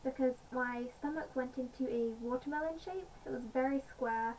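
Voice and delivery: ordinary talk, nothing marked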